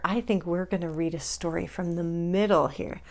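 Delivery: hushed secret whisper. Real, not acted. Host whispers to kids about the bookmark. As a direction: share it like a secret.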